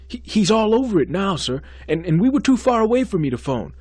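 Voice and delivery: high, loud nervous excuses; anxious stammering. Acted. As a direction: stumble over words in fear. Student stammers excuses to dean.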